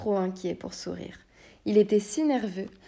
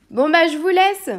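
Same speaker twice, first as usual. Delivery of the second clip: much higher, loud, biting farewell; angry knight leaving, pitched up and loud; furious sendoff; 10 semitones higher, 11 dB louder than usual. Acted. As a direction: spit it out still mad with rage. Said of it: "toujours fou de rage".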